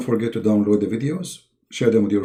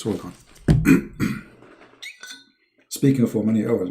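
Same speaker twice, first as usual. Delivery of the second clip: normal talking voice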